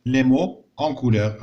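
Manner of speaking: les mots en couleur read word by word, very slowly; slow, deliberate dictation reading. Shown as a demonstration. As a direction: spell it out slowly for the class. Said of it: "قال لك observe".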